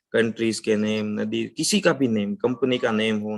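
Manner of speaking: ordinary talk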